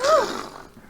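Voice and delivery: high dramatic gasp; sharp gasp. Acted. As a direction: gasp in shock. Door flying open.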